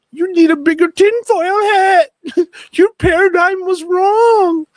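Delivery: high, loud shouting with bursts of laughter; loud, high-pitched taunting of the doubters; gleeful shouted rant. Real, not acted. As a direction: shout it way up high, half cracking up.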